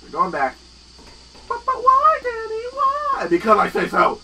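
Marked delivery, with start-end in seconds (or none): 1.5-3.3 put-on very high, loud whining kid; squeaky crying little Billy; whiny child voice. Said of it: "you should have a voice like this". 3.3-4.2 stern dad. Pretend. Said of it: "such a low voice".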